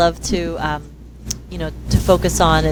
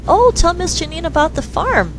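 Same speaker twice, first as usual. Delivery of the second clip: high, sing-song coaxing mother; coaxing mom; 10 semitones higher than usual. Retold or imitated. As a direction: urge the shy girl to speak, voice up high.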